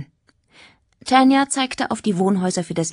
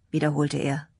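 usual speaking voice

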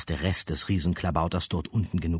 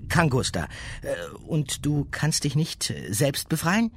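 put-on higher young girl voice; 9 semitones higher than usual. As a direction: ask as a girl. Girl character asks.

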